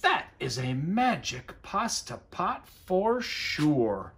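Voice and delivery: slow wondering delight; a touch of awe at the pot; amazed. Acted. Big Anthony marveling at the magic pot, slow amazed voice. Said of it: "said Big Anthony".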